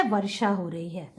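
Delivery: hushed whisper, no voice; whispered rain line. Shown as a demonstration. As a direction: breathe the words to the class. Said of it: "teacher whispering to children".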